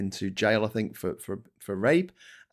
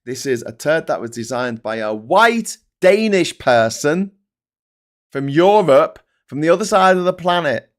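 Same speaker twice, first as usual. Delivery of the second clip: ranting reply; 7 semitones higher, 7 dB louder than usual. Retold or imitated. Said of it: "I'm like".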